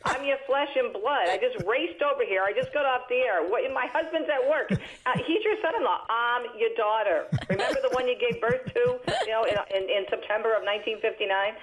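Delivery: high, half-laughing indignant protest; mock exasperation. Daughter protesting to her mom, high and laughing. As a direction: play the hurt daughter, laughing.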